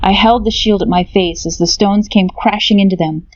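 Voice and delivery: usual speaking voice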